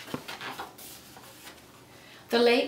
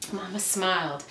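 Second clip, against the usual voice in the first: usual speaking voice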